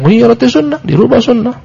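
mild mock outcry, pitched high; retold shout. Preacher mimicking those rebuked over sung dhikr, mild high-pitched outcry. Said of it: "mereka berteriak".

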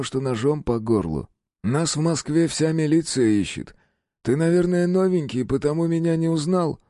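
high, easygoing crook voice; chatty self-satisfied thief; smug gangster. A narrator's reading. Gangster explains himself.

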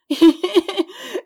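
a high, loud wailing sob. A narrator's reading. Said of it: "elle pleurait à sanglots".

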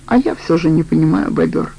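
ordinary delivery

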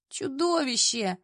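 slow, drawn-out moan; groaning. A narrator's reading. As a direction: groan it from the floor, half awake. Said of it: "простонала я с пола". (медленно, со стоном)

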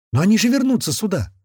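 high frightened plea. A narrator's reading. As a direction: say it in fear they'll return. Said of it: "испуганно произнесла женщина". (испуганно)

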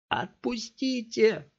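high, slow, straining cry; desperate plea. A narrator's reading. Scott pleads with the thugs, struggling. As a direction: fight to get loose.

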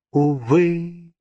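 sigh. A narrator's reading. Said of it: "вздохнула Джиневра".

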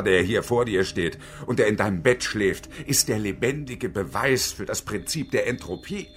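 normal talking voice

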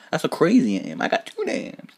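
normal talking voice

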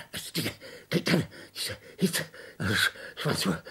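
soft, fast, breathless whisper; hushed feverish murmur; faint whisper. Acted. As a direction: murmur it as the strength drains. Delirium, voice sinking to whisper.

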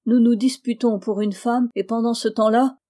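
ordinary delivery

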